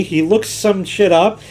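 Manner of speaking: ordinary talk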